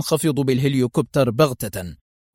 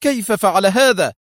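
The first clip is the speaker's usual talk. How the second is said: higher, louder shocked question; startled exclaim; 6 semitones higher, 4 dB louder than usual. Acted. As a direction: cry out caught off guard. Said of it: "وهتف".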